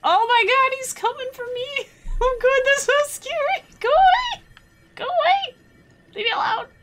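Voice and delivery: high panicked shrieks at a chasing monster; scared squeal. Real, not acted. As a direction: squeal in playful fright. Boss chasing her, squealing.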